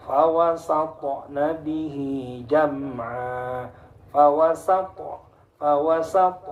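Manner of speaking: FAWASATNA recited slowly, T released as a bounced 'to'; bouncing qalqalah. Shown as a demonstration. Teacher recites ta example, slow bouncing qalqalah. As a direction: chant FAWASAT with an echo after the final T. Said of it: "Harus memantul".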